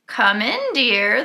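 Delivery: usual speaking voice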